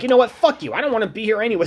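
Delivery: high, sharp fuck you, storming off; snapping at the girl before walking out; retold angry outburst. Lashing out at his classmate in a high mock-angry voice. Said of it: "I'm like".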